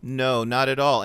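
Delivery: ordinary delivery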